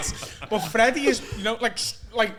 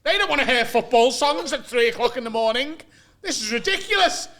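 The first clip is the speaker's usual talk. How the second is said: higher-pitched indignant flatmate; mock angry rant; 5 semitones higher, 6 dB louder than usual. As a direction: tell them off in mock outrage. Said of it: "he's proper screaming at me".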